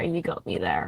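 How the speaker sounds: low put-on character voice. Pinned crewmate complaining about losing an arm, low and rushed. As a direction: drop the voice low and hurry it.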